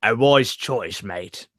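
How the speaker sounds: low, slow put-on Cockney; Cockney accent. Co-host reads requested line as merchant, Cockney. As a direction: deepen and slow it, imitating Cockney. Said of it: "with a Cockney accent".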